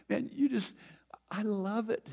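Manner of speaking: delighted whisper, no voice at all; God whispering his delight. Retold or imitated. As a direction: breathe it like a secret joy. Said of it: "he's saying".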